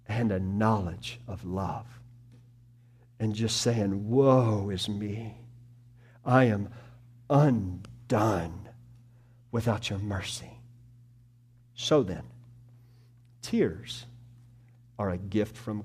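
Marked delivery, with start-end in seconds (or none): 4.1-10.6 lament. Acted. Voicing a broken sinner's lament.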